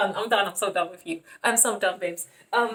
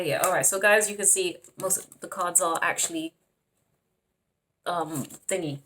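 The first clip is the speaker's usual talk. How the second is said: ordinary talk, nothing marked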